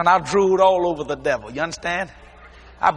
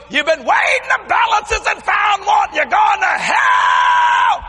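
loud, slow, much higher proclamation; fiery street-preacher shout; shouted preaching; 13 semitones higher, 5 dB louder, slower than usual. Retold or imitated. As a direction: call the diners to repent. Preaching aloud in Denny's.

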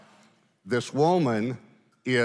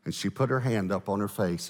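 ordinary talk, nothing marked

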